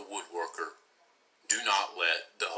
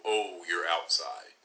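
ordinary delivery, nothing marked

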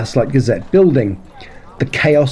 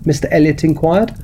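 ordinary delivery, nothing marked

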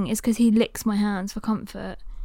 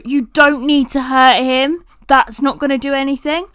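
raised voice, imitating a shout at someone; firm, louder re-enacted telling-off; retold shout; 2 semitones higher, 8 dB louder than usual. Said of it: "I shout at the man".

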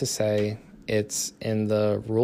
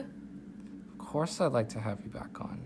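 soft unvoiced whisper; 11 dB quieter than usual. Real, not acted. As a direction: breathe it in a whisper. Whispered aside to guest.

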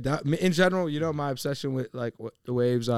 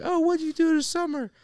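very high-pitched schoolkid voice; mock little-kid chirp; first-grade kids. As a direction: imitate an eager first grader asking classmates. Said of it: "first grade to say all the kids".

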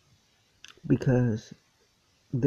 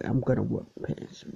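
normal talking voice

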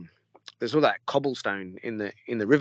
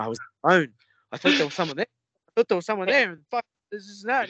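imitating a spooked man, voice climbing higher; scared, shivering; 7 semitones higher, 4 dB louder, faster than usual. Hiker recalling the Yowie sighting, imitated shaky fear. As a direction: retell it like he's still rattled. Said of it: "he's the shivering in his voice".

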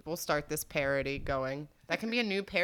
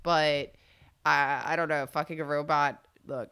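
ordinary talk

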